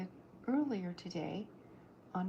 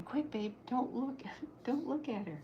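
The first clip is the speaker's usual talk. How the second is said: urgent warning; 6 semitones higher than usual. Acted. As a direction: hurry him, half laughing. Lola warns hero about villain.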